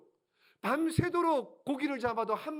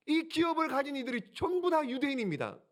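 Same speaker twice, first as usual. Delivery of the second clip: ordinary talk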